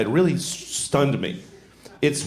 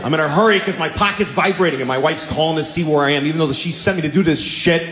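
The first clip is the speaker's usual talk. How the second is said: usual speaking voice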